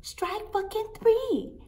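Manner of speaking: very high-pitched indignant rant; outraged outburst. Retold or imitated. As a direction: explode in squealing outrage. Outburst after throwing up on herself.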